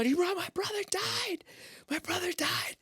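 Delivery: very high, strained, wailing repetition; mock anguish. Cameraman telling coworkers his brother died, mock anguished wail. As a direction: play up the grief in a cracked high voice.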